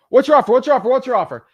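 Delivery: loud, high, repeated demand; screaming seller. Retold or imitated. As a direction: shout it at the caller. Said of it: "when someone screams that at me".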